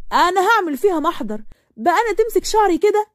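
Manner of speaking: furious at having her hair pulled; angry outburst. A narrator's reading. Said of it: "بعصبية وزعقت".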